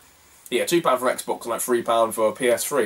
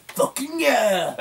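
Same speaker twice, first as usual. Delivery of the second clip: high, drawn-out mock kid whoop; little kid cheering; 11 semitones higher, much slower than usual. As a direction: squeal it like an excited eight-year-old. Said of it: "the little kid just goes".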